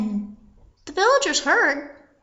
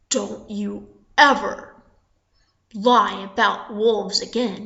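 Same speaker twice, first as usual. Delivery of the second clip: low, slow, spaced-out warning; low-pitched stern telling-off; stern scolding; 5 semitones lower than usual. Acted. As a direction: scold slowly, word by word. Villagers scold the boy.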